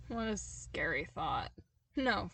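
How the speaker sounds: plain reading voice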